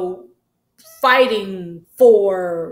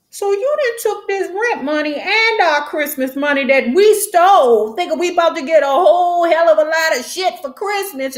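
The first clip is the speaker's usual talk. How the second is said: much higher, indignant accusing voice; girls confronting Electra; 7 semitones higher than usual. Retold or imitated. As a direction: call her out over the stolen money. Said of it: "The girls are like".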